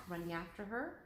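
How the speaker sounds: ordinary delivery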